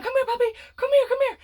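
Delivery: high coaxing call to a puppy; high child calling a puppy. Retold or imitated. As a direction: sweet-talk the puppy over, pitched high.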